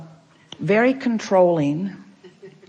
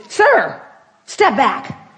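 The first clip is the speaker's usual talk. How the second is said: sharp, much higher and louder command; barked order; 7 semitones higher, 5 dB louder, much slower than usual. Retold or imitated. Airport official ordering a man back from the line, barked loud command. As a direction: snap it like a strict airport official. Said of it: "she's barking at everyone".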